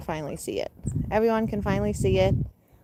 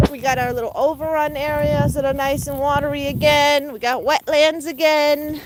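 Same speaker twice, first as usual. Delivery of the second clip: high sing-song call; calling pets; 7 semitones higher than usual. Real, not acted. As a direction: coax them in a high voice.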